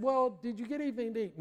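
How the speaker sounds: high, quick acted query; prompting question. Chaplain checks whether sailor has eaten, high prompting question.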